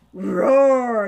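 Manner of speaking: sudden loud roaring yell; bear roar. Acted. Narrator breaking off as the bear roars, loud roar. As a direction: burst out like a beast.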